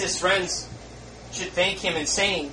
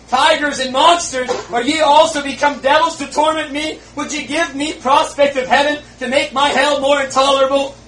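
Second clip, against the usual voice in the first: loud, higher anguished outcry; exclaimed; 9 semitones higher, 8 dB louder than usual. Acted. Dying man refusing prayer, loud anguished exclaiming. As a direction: hurl it at them in horror. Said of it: "He turned his face and exclaimed".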